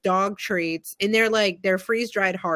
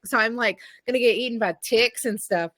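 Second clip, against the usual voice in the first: usual speaking voice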